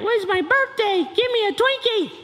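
put-on high-pitched child's demand; whiny little kid. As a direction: whine it like a small child. Kid insisting on birthday.

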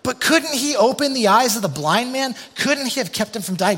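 high, loud, puzzled doubt; mock confused onlooker; confused questioning. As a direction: ask it baffled and bewildered. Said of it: "some of them were confused".

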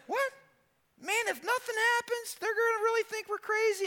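very high, anxious squeak of worry; panicky disbelief. Retold or imitated. Thomas fearing nothing happens at the tomb, high panicky voice. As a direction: panic that they will look crazy.

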